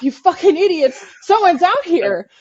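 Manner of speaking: yelling to the shooters that someone is out here; furious yell. Retold or imitated. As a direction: bellow it to be heard over gunfire. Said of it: "'cause I'm mad and I'm freaking out".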